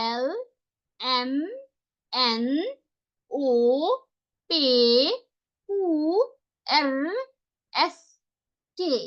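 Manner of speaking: each German letter sounded out slowly with pauses; German alphabet read aloud. Shown as a demonstration. Teacher to online class, reciting the German alphabet slowly. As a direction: spell out the alphabet letter by letter, pausing between. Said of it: "আরেকবার".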